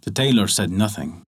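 plain reading voice

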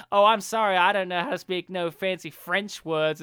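Southern prosecutor mock-apologising; thick Southern accent. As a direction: lay on a folksy Southern accent. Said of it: "thick Southern accent".

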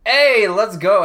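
high, loud, slow cheer; excited cheer. Real, not acted. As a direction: cheer himself on. Cheering on being introduced.

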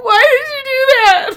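high, loud crying whine at the dog; mock crying wail. Venting at the dog over the broken ornament, high tearful wail.

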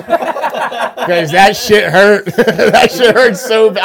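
slow, laughing through the words. Real, not acted. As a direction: laugh as you remember the pain. Recalling painful hot sauce.

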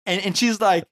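ordinary delivery

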